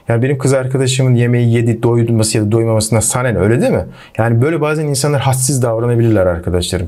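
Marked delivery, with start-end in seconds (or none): none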